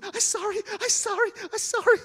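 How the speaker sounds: high, loud wailing apology; acted hysterical pleading; groveling. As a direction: beg for your life, shrieking. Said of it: "on the ground groveling".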